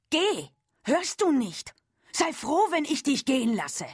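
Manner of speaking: wütender Schrei, viel höher und lauter; brüll das Mädchen aus dem Laden; schreiend. A narrator's reading. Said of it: "Sie schrie". (die Verkäuferin schreit Ellen an, wütend und laut)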